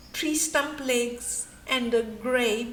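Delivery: ordinary delivery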